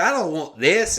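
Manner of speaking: high-pitched comic refusal; mock indignant refusal. Customer refusing the cheap whiskey, mock indignant refusal. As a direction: push it up high in protest.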